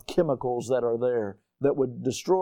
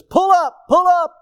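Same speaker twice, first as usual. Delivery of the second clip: high, loud urgent alarm call; imitated warning voice, shouted high; warning conscience; 9 dB louder than usual. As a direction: cry it out like a cockpit warning. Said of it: "The conscience says".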